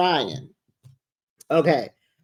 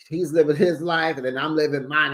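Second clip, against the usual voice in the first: ordinary talk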